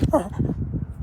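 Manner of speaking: high-pitched wordless whimper; imitated dog whine. Showing how the excited dog whined, whining. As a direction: mimic a dog's excited whine. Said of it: "whining so loud".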